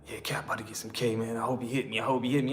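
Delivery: low, hushed, sly mutter to himself; a soft, scheming aside from the cellmate; hushed celly voice. Retold or imitated. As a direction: voice the greedy cellmate under his breath.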